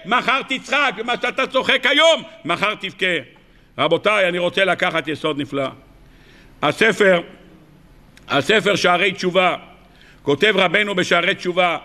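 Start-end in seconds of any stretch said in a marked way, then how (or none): none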